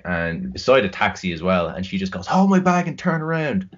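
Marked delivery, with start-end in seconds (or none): 2.3-3.7 put-on drunk coworker's demand.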